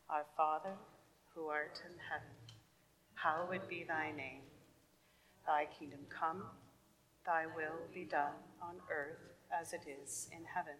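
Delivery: soft, steady group prayer recitation. Real, not acted. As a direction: recite the prayer quietly and evenly. Leads the Lord's Prayer.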